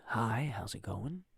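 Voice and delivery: low, smooth radio announcer voice. Shown as a demonstration. Streamer shows off his new mic in DJ voice. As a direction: roll it out like a classical DJ. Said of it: "that's my classical D J voice".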